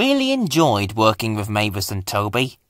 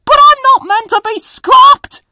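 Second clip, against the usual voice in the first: much higher and louder desperate cry; high panicked protest; 10 dB louder than usual. Acted. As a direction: engine pleading as the grabber closes in. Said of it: "he cried".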